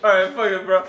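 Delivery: ordinary talk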